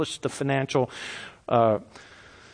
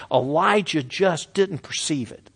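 ordinary delivery

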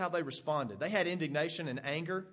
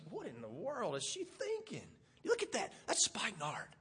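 scornful murmur; 6 semitones higher than usual. Pretend. Disciples muttering about the costly perfume, indignant. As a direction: grumble at her waste in disbelief.

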